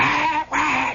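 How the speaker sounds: high imitated rooster squawk; mimicked screech of two birds. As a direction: squawk like angry fighting cocks. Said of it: "these two birds are going".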